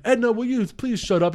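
high, fed-up plea; exasperated kidnapper. Retold or imitated. Kidnapper to the mom in the car, exasperated. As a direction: beg her to stop talking. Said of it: "the guy's like".

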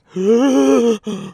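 sharp gasp of shock; dramatic gasp. Retold or imitated. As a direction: gasp as if stunned. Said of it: "I was like".

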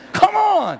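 high loud playful exclamation; teasing shout. Real, not acted. Preacher teases Jets-fan congregation, high loud exclamation. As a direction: call it out teasing the crowd.

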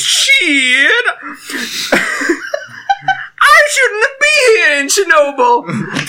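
hammed-up American accent, laughing through it. Italian guy putting on a bad American accent. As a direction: cry out like a panicking American stoner. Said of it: "my impression of an American accent".

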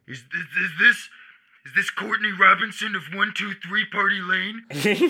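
strange, halting stranger's voice with a rise; hesitate, then ask like a sinister stranger on the phone; creepy caller. Retold or imitated. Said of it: "then you hear".

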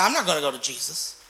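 low, quiet, sulky refusal; upset sulking. Retold or imitated. Preacher voicing Mary sulking about going to Jesus, low and quiet. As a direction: mutter it low like a hurt sister. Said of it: "She was upset".

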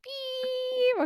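squeaky high mock beep. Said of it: "let's pretend it just started".

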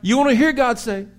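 loud, high, driving sermon voice; full-force preaching. Acted. Pastor drives home marrying the right one, preaching full force. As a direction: preach it, building to a shout.

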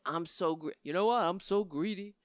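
low, soft put-on voice for the greedy line; a hushed pretend Obama; imagined Obama saying it. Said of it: "Imagine Barack Obama getting up... saying".

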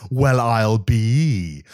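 very slow drawled exclamation; drawn out. Shown as a demonstration. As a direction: stretch every word out. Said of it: "Well is a thing that we have".

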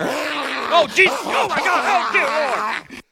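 high, loud hammed-up shrieks of pain; mock panic. A bitten staffer cries out to God, in high, loud mock panic. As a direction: yelp like you just got bitten.

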